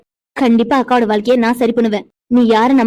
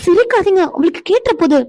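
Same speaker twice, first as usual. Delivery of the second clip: sharp, much higher protest; high scolding; 7 semitones higher than usual. Acted. Wife scolds husband for laughing, much higher and sharp. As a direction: tell him off for laughing.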